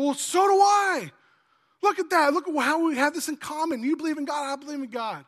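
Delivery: loud, high shout of delight, easing off; thrilled agreement. Acted. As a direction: exclaim big and high, amazed at the match. Acted joke, thrilled at shared belief.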